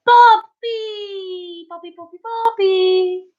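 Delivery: high, excited call of a name. Real, not acted. Calling out to a viewer.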